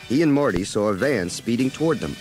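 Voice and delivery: usual speaking voice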